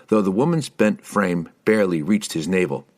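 usual speaking voice

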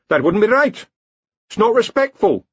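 high, loud scandalised villager; pious villager. A narrator's reading. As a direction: object piously.

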